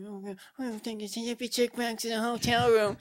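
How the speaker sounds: high, airy put-on female voice; imitating hippie chick. Hippie chick commenting in a hotel room, high imitated voice. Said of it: "the hippie chick was like".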